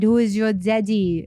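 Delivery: lightly attempted German accent; bad German accent. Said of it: "I can't even do a German accent".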